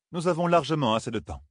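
mildly solemn, pitched high; solemn declaration. A narrator's reading. Coiren assures companions there is time, mildly solemn. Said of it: "une déclaration solennelle".

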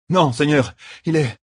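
put-on high-pitched, urgent young soldier; breathless young messenger. A narrator's reading. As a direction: answer your general urgently, pitched high. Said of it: "un jeune gars à bout de souffle". (jeune messager pressé, voix haute)

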